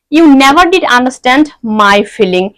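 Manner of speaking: never and did stressed, spoken very slowly; emphatic did. Shown as a demonstration. Teacher showing students emphatic did with a hurt feeling, slow and stressed. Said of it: "did আমরা আমাদের ফিলিংকে জোর দিয়ে বলতেও".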